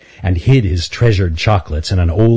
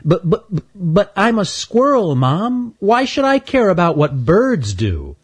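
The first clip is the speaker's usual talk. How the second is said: high, stammering little squirrel; young squirrel voice; 11 semitones higher than usual. Acted. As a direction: play a nervous young squirrel pleading with mom. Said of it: "young squirrel Stanley stammers, high voice".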